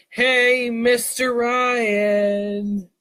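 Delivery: slow, drawn-out crowd greeting; crowd cheer. Acted. Audience greeting Mister Ryan in unison, slow drawn-out chant. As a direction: stretch it out like a whole audience answering. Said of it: "The audience then responded by saying".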